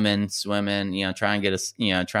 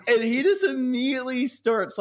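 laughing. Real, not acted. Laughing while telling.

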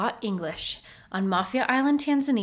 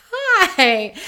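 high, loud, drawn-out "hi"; eager greeting, re-enacted; 10 semitones higher, 9 dB louder than usual. Retelling herself answering Dan's greeting, eager high hello. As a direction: play up an eager hello, pitched way up.

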